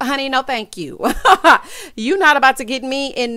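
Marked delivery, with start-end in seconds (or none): 1.0-1.9 laugh. Real, not acted. Laughs at therapist line.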